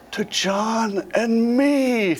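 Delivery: slow, deliberate To John and me with the weight on me; pointed correction. Retold or imitated. Dying grammarian father correcting daughter, slow pointed stress on me. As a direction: correct her grammar slowly, word by word. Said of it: "he bends over, he says".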